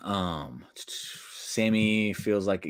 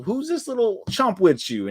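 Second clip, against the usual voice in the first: Godfather; 12 semitones higher than usual. Retold or imitated. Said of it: "Godfather's looking and goes".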